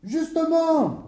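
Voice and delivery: high, sharp comeback; angry official's high retort; irate retort. Acted. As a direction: cut him off angrily, pitched high. Irate official cuts the owner off.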